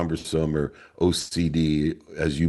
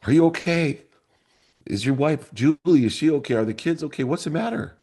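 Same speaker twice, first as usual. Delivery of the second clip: higher voice of an anxious mother; worried mom; 11 semitones higher than usual. Retold or imitated. Mom at the door asking her crying son, worried. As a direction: ask in rising alarm, as a mother.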